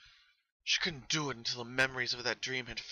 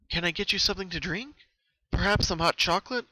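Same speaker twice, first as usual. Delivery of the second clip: high, louder hostess voice; kind offer; 7 semitones higher, 6 dB louder than usual. Acted. Twilight offering Pinkie her favourite drink, high kind voice. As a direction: offer a treat eagerly.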